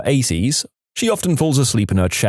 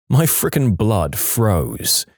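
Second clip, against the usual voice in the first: usual speaking voice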